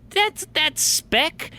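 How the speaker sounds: very high, drawn-out squeal of disbelief; incredulous outburst. Retold or imitated. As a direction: squeak it out in mock indignation. Retells disbelief at tiny blood speck.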